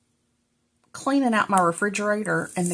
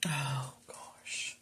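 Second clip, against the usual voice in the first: whisper; 7 semitones lower, 15 dB quieter than usual. Real, not acted. Unvoiced after a mishap.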